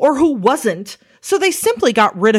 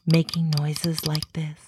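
hushed, softer ASMR delivery; ASMR demo; 4 semitones lower, 8 dB quieter than usual. Shown as a demonstration. Creator demonstrating ASMR noises to viewers, hushed and soft. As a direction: drop low and soft, as in an ASMR video. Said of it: "I was doing some A S M R".

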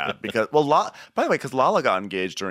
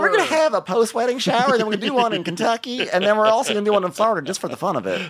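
high giddy bride voice; mocking Brittany; 11 semitones higher than usual. Friend mimics Brittany planning more parties, high and giddy. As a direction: gush like an overexcited bride. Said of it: "Is Brittany still having parties".

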